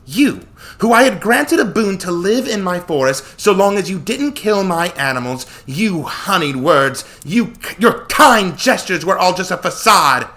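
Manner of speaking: high, slow, wrathful goddess; outraged denunciation. A narrator's reading. Artemis accuses Joshua of betraying her trust, outraged denunciation.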